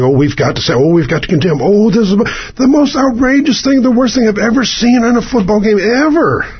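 high, hammed-up pearl-clutching announcer; mock outraged commentator. Mocking the TV crew's overreaction, high shocked squeal. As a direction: squeal it like a scandalized pundit. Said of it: "felt like".

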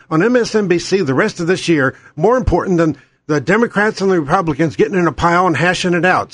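normal talking voice

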